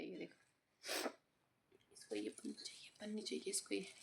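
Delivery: secretive whisper to viewers. Real, not acted.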